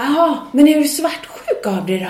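high, loud pushy question; shouting drunk woman. Retold or imitated. Drunk woman presses the singer, loud and high. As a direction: push the question like a tipsy heckler. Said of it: "skrika på mig".

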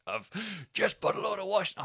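high, exasperated mock shout; retold shouting. Bloke shouting up about his washing, mock exasperation. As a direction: imitate a man complaining from below. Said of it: "he's shouting at us".

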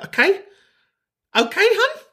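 high-pitched camp sass; sassy high "okay hun". Pretend. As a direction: squeal it out, mock offended. Sassy mock rebuke to caller.

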